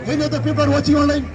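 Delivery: ordinary talk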